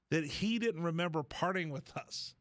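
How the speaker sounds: ordinary talk, nothing marked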